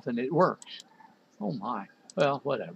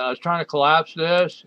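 louder, higher "collapse this", pressed at the program; exasperated emphasis; 4 semitones higher, 8 dB louder than usual. Real, not acted. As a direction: repeat it louder so the computer finally gets it. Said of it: "say that in a certain tone of voice".

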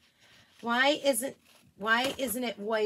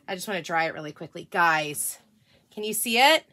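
normal talking voice

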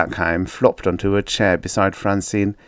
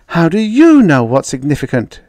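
higher, louder challenge; demanding; 7 semitones higher, 8 dB louder than usual. A narrator's reading. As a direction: demand an answer sharply. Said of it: "she demanded".

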